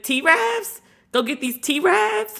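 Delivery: high, incredulous T-Ravs; mocking disbelief. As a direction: sneer at the word T-Ravs from way up high. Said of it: "We be like".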